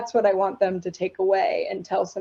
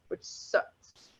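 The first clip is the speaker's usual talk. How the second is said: whispered aside; 12 dB quieter than usual. Real, not acted. Aside complaint about limits.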